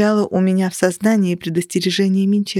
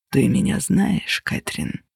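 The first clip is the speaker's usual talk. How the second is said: soft whisper; 6 semitones lower, 4 dB quieter than usual. A narrator's reading. Figure asks softly.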